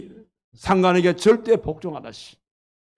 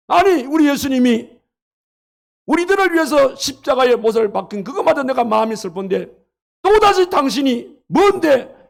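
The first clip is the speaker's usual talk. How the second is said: higher, louder indignant yelling; angry shout; 7 semitones higher, 5 dB louder than usual. Acted. Booth in his dream yelling at the man hammering nails, angry shout. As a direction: shout at him to stop. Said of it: "막 화가 나가지고 소리를 지르니까".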